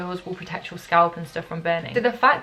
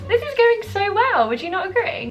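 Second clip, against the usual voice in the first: mock cheerful sarcasm; 12 semitones higher, 4 dB louder than usual. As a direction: squeal it with ironic delight. Sarcasm after bleach in mouth.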